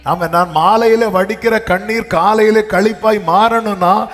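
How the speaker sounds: high, loud sermon climax; impassioned preaching. Acted. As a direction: drive it home, pitch climbing as you go.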